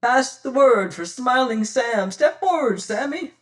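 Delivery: a commanding pirate captain calling out loud and high. Acted. Pirate captain summoning his crew, a loud commanding call. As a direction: summon your man across the beach.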